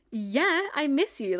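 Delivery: high, defensive protest; retold indignant reply. Retelling her answer to him on FaceTime, indignant. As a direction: push back as if it's obvious.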